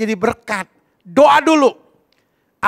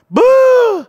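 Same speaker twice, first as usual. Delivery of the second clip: high, loud scornful exclamation; shouted beuh; 14 semitones higher, 6 dB louder than usual. Retold or imitated. Husband scoffing at his wife, loud and high. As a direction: blurt it out in disgust.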